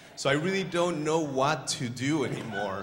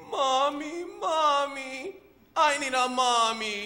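high, loud little-bird wail; hammed-up baby bird crying for its mother; mock crying; 13 semitones higher, 5 dB louder, slower than usual. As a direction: play a chick wailing in a high voice. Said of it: "he started to cry".

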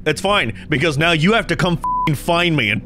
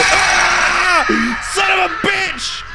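jump scare scream; 14 semitones higher, much slower than usual. Real, not acted. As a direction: yelp from a sudden fright. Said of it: "They f-, jump scared me".

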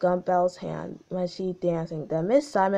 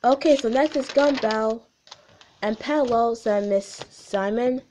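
usual speaking voice

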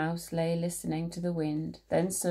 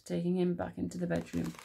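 ordinary delivery, nothing marked

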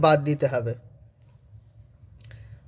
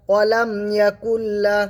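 Quran recitation; 4 semitones higher than usual. Shown as a demonstration.

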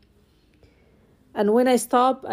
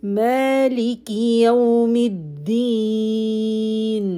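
Quran recitation. Acted. Teacher reciting the fourth ayah.

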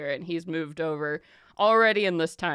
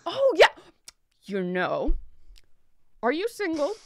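much higher, eager prying; pry with sly glee; nosy merchant; 9 semitones higher, 3 dB louder than usual. Acted. Merchant playing matchmaker.

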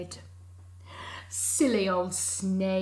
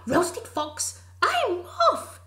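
much higher and louder panicked squeal with a laugh; panicked fox; 12 semitones higher, 8 dB louder than usual. Acted. Fox fleeing the mouse in a high panicked shriek. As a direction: shriek it and run.

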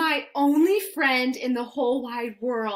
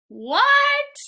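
loud, very high "what"; shocked disbelief; 13 semitones higher, 5 dB louder than usual. Acted. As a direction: squawk it in comic outrage. Reader shocked by the silly song.

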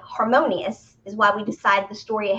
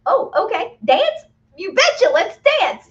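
much higher and louder, bubbling over; delighted yes; 9 semitones higher, 7 dB louder than usual. Acted. Speaker acting out thrilled yes to dancing, high and eager. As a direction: jump at the invitation to dance. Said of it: "You went".

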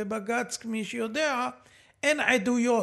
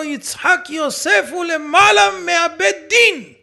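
much louder and higher, shouted out in full; shouting; 5 semitones higher, 11 dB louder than usual. Retold or imitated. Host acting out Deri shouting at the old rabbi, full shout. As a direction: bellow it as the quoted man yelling at the rabbi. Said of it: "צועק על הרב".

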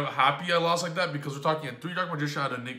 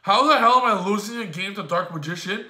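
louder, incredulous gamer rant; exasperated outburst; 5 semitones higher, 7 dB louder than usual. Retold or imitated. Player venting at his own loss to Dark Magician, loud and incredulous. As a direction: yell it in disbelief at losing. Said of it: "I'm like".

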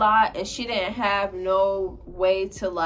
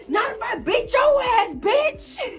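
shouted threat; 14 semitones higher, slower than usual. Retold or imitated. Narrator threatening the friend mid-fight, shouting. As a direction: yell it like you're ready to fight. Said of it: "And I said".

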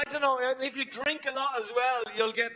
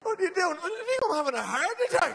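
mock panic; 9 semitones higher than usual. Acted panicked pub exchange.

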